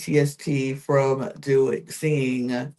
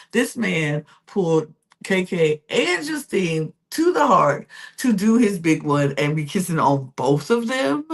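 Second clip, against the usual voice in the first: ordinary talk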